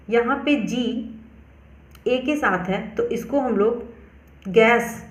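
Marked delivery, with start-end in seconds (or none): 4.5-5.0 गैस opening on a G sound; hard G. Shown as a demonstration. Teacher reading gas for learners, hard-G pronunciation. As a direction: say गैस with G, not J. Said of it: "पढ़ेंगे".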